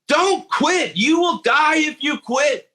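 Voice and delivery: high, urgent don't-quit cry; retold yelling. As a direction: teammate yelling at a player not to quit. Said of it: "another guy was yelling at another guy".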